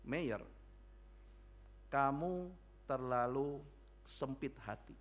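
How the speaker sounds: measured, weighty rebuke, words set apart; God's rebuke. Retold or imitated. God rebuking Mayer for a narrow heart, grave reproof. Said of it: "dia ditegur oleh Tuhan".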